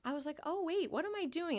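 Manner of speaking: retold surprise. Her own surprised reply retold.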